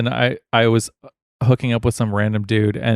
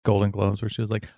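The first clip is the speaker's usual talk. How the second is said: ordinary delivery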